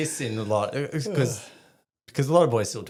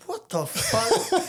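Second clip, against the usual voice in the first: shocked; 4 dB louder, much slower than usual. Retold or imitated. Soldier's shocked reaction.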